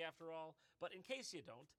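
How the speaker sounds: normal talking voice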